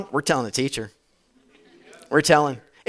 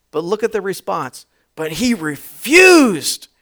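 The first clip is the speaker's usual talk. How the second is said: usual speaking voice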